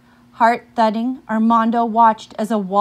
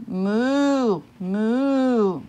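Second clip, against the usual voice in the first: cow moo. Acted. Cow moos in story.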